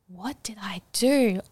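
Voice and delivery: whispered to yourself in dismay; regretful whisper. Retold or imitated. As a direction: hush it like a private regret. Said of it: "And I can sit here and say".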